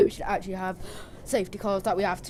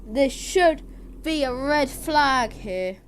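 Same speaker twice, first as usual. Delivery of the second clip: loud, excited play-by-play, much higher; live race commentary; 6 semitones higher, 6 dB louder, slower than usual. Acted. Streamer calling a sim race for viewers, excited commentary.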